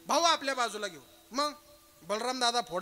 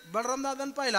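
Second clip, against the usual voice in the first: usual speaking voice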